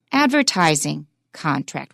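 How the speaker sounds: "Advertising" carries the accent, "contract" falls away; compound stress. Shown as a demonstration. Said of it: "We don't stress contract".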